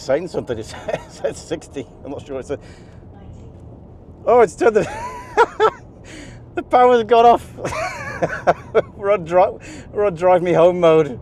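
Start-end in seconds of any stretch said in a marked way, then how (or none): 4.3-4.8 loud, high, breaking into laughter; exclaim, about to burst out laughing; amused dismay. Real, not acted. Driver as the car cuts power, amused dismay. 4.9-5.7 high-pitched burst of laughter; helpless laughter. Real, not acted. Driver as the power cuts, laughing helplessly. 6.5-11.2 loud, high, broken up by laughter; laughing dismay. Real, not acted. Driver reporting lost power, laughing in dismay.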